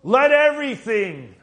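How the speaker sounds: preaching climax. Acted. Preacher shouting the psalm's ending to the church. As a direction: drag every word out in ecstatic praise.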